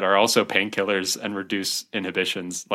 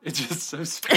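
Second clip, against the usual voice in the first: giggling through the words; barely holding in laughter; stifled giggles; 4 semitones higher than usual. Real, not acted. Giggling over odd news.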